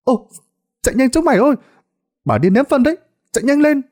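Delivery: put-on high, urgent child's shout; panicked children. A narrator's reading. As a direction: warn your friends to flee, in a high panicked voice. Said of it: "khiến chúng nó hốt hoảng nháo nhào". (giọng trẻ con hốt hoảng, la gọi)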